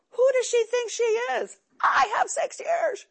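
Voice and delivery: high, slow, huffy mock outrage; mock indignation. Mocking her younger self to the meeting, high huffy outrage. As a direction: parody her own old arrogance.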